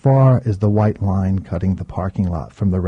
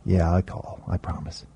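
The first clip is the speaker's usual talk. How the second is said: choked, much softer reply; hushed, holding back tears; quiet; 3 semitones lower, 10 dB quieter than usual. A narrator's reading. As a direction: barely get the promise out. Narrator voicing himself, choked farewell.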